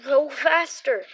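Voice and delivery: loud, slow desperate shout; cried out. A narrator's reading. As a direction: bellow the order in panic. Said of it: "Donnie cried".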